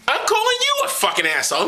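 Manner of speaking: normal talking voice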